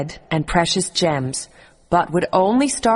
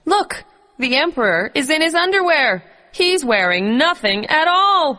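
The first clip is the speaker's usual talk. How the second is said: put-on child shouting; 9 semitones higher than usual. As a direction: call it out like a small boy. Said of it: "a small boy standing in the crowd shouted".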